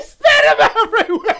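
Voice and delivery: high, loud, cracking with laughter; words burst out mid-laugh; laughing hard. Real, not acted. Helpless laughter at the mess.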